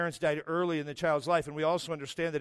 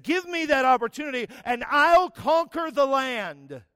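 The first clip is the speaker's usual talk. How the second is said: loud, higher, slowed proclamation; bold declaration; 8 semitones higher, 7 dB louder than usual. Retold or imitated. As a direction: declare it like a warrior claiming land. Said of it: "he said".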